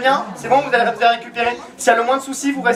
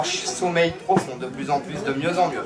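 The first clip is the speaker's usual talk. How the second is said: hypnotic deepening; 7 semitones lower, 5 dB quieter than usual. Acted. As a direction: draw the sleeper down deeper.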